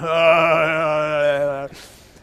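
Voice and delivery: long open-mouthed overwhelmed cry; acted kid noise at a mountain of food; sustained loud falling wail; loud drawn-out "haaah". Imitates self facing mountain of food.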